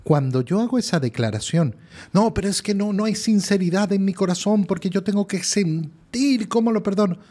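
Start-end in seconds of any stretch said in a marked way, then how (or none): 2.1-7.2 doubting believer's objection. Retold or imitated. Imagined objector answered by priest.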